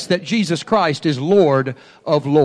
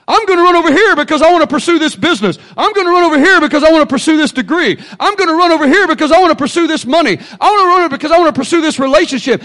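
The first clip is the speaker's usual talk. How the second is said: fast, higher, louder mock voice; restless chaser; 9 semitones higher, 8 dB louder, faster than usual. Preacher mocking people running after their own plans, fast and high. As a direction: rattle off plans like a busybody.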